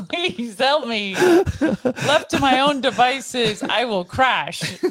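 very slow, drawn-out comic plea through laughter; pleading prayer. Retold or imitated. Praying each morning for help, slow half-laughing plea. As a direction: mock-desperate prayer, every word stretched. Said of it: "that's why I pray every morning".

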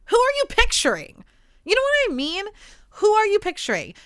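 sighing, high, incredulous question; can't believe what she's hearing; exasperated high-pitched who are you picturing; exasperated disbelief. Real, not acted. Exasperated at dating show guy.

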